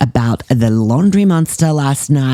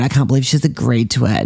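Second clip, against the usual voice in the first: ordinary talk, nothing marked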